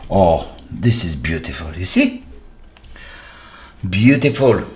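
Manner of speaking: usual speaking voice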